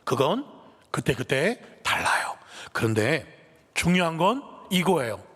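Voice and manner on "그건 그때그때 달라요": usual speaking voice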